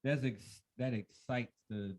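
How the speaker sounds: normal talking voice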